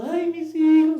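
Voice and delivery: sudden high, loud mock lament for his sons; mock wailing. Aaron mourning his sons, in a hammed-up high loud wail. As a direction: wail it like a grieving father, hammed-up. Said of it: "sirviendo ahí llorando".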